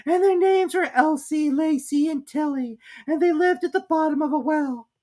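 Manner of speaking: high, hurried little creature voice; high squeaky Dormouse. A narrator's reading. Dormouse hurrying to start the tale. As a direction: squeak it out in a rush like a sleepy mouse.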